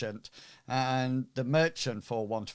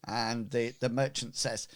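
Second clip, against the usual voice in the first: ordinary talk, nothing marked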